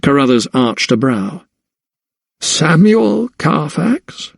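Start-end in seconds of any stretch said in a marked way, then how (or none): 2.4-4.3 high, drawn-out questioning echo of the name; slow, high-pitched query; questioning repeat. A narrator's reading. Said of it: "he repeated".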